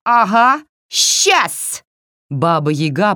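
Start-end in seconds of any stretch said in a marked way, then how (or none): 0.0-1.8 very high, loud witchy scoff; sarcastic, high witch refusal; jeering witch. A narrator's reading. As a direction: scoff it in a screechy hag voice. Yaga jeers a refusal.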